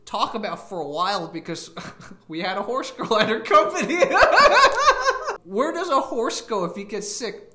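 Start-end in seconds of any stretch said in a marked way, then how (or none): 4.0-5.4 shrieking laugh; loud high laugh. Real, not acted. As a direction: burst of high-pitched laughter. Laughing at own joke.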